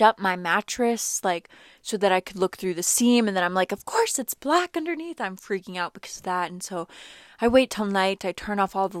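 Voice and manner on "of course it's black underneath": pitched way up in mock horror; high, disbelieving mock panic; alarmed squeak. Discovering the mattress seam.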